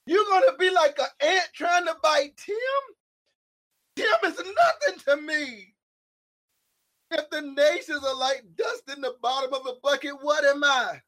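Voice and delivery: impassioned sermon voice; preaching at full force. Acted. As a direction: thunder it at the congregation like a pulpit preacher.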